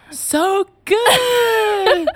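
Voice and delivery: high, loud over-the-top praise; fake delight. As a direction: pretend the soup is wonderful. Faking enjoyment of mutton soup.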